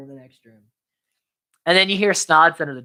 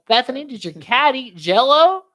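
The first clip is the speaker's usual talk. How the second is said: raised, high-pitched question; acted high, careful asking; calling to aunt; 9 semitones higher, 3 dB louder than usual. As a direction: pitch it up for someone hard of hearing. Calling to hard-of-hearing aunt.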